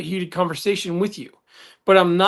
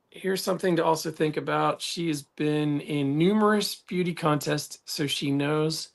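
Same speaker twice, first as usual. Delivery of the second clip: reading aloud; 4 semitones lower, 9 dB quieter than usual. Real, not acted. Reads a comment aloud.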